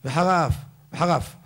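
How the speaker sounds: וחרה אף collapsing into one word וחרף; swallowed letter. Shown as a demonstration. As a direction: slur וחרה into אף until it is וחרף. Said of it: "תבוא לבלוע אות אחד". (וחרה אף נבלע לכדי וחרף)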